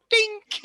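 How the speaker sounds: high, sharp mock dink; ping it out like a weak bell; dink sound effect. GM voicing the pathetic hammer result, high dink. Hammer barely moves the puck.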